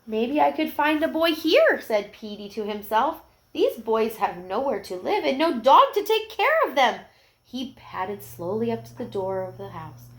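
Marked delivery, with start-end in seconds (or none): 0.1-7.0 higher hopeful puppy voice; hopeful little dog. Acted. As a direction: brighten up as a new idea comes. Petey voiced in story.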